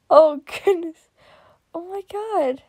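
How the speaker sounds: usual speaking voice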